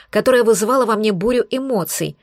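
plain reading voice

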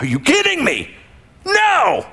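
a reader who can't believe the plot twist; outraged disbelief. Retold or imitated. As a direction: voice shooting up high on the No. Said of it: "you're like".

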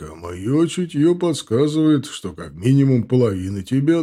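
loud, jeering orc; mocking insult. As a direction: taunt him with crude insults. Indgar insulting loudly.

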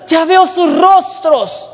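high, drawn-out mock amazement; excited exclamation. Healed man telling those around him, excited exclamation. Healed man's astonished cry retold.

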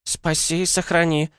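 put-on high-pitched, slow elderly villager voice; shocked old woman. A narrator's reading. Elderly villager shrinks from dark-faced strangers, high and shaken. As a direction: gasp out a prayer in a high, startled voice. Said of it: "забормотала потрясённая женщина".

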